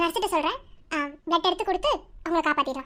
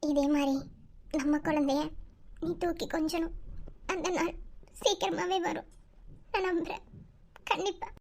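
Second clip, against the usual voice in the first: soft, wistful inner voice; tender, hushed; 7 dB quieter than usual. Acted. Wife longs to give husband a child, hushed tenderness. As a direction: promise quietly, as if to yourself. Private longing for own child.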